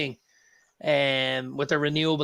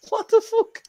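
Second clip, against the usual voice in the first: mock astonished yelp, much higher and louder; stunned disbelief; 5 dB louder than usual. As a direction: throw it up high like you can't believe it. Retold shock at the plain-clothes cop.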